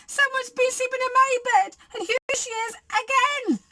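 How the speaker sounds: high, frightened little bear; wailing Baby Bear. A narrator's reading. Baby Bear hopes it's a nightmare, high wail. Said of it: "he wailed".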